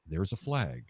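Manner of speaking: soft, low, deadpan announcer call; boring announcer. Retold or imitated. Mocking the dull announcer on TV, soft and flat. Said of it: "the announcer goes".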